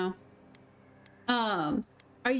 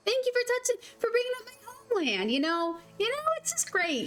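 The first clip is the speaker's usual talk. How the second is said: put-on high, nasal Minnesota mom accent; Midwestern accent; 4 semitones higher than usual. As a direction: gush like a Midwestern soccer mom. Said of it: "A heavy Midwestern accent?".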